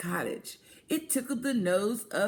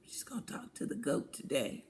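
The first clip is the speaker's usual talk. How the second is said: whisper; 7 semitones lower, 8 dB quieter, faster than usual. Acted. Storyteller whispers gossip aside.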